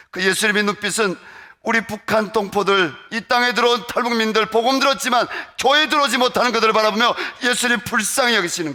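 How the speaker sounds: loud, high preaching for North Koreans; impassioned preaching. Acted. Preacher pleading for North Korean defectors, loud and high. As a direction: cry it out to the congregation.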